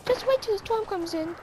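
high squeaky threat; high-pitched mock menace; high Elmo-like voice. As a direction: warn him in a puppet voice. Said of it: "Do I sound like Elmo?".

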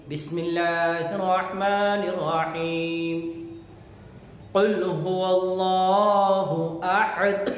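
slow, melodic tajweed chant; measured, sustained recitation on a steady note; Quran recitation. Shown as a demonstration. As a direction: chant it with long, drawn-out vowels. Quran recitation, second time through.

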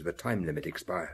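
normal talking voice

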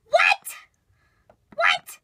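very high, loud, rising disbelief; shocked shriek. Acted. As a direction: shriek it as a stunned question. Stunned reaction to the zit.

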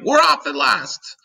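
high, loud exultant yell; excited shout. A narrator's reading. Someone shouting to the sleeping creatures, excited shout. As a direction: call it out high and triumphant. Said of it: "someone was shouting".

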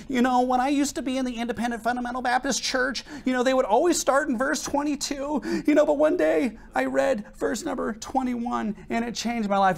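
mocking soy boy. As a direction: mimic him in a high, prissy parody voice. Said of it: "he's like".

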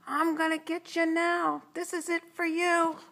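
high, loud villain threat; menacing chase yell. A narrator's reading. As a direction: holler after the fleeing kids. Pursuer threatens fleeing kids.

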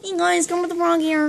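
Kermit the Frog. Acted. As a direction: announce yourself like a puppet frog. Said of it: "Now we're gonna do Kermit the Frog".

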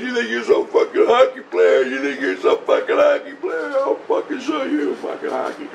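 very high, taunting mock rant; hammed-up high-pitched bully; imitated yelling. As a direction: jeer in a high raging voice. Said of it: "And he's yelling at me".

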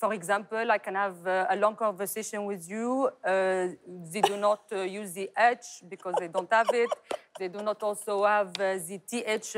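lightly French-accented English; dropping the H, a French R. Shown as a demonstration. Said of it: "لو French". (لهجة فرنسية خفيفة في الإنجليزي)

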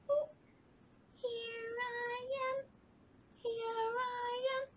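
high, slow little mouse voice; a shy tiny mouse answering. Acted.

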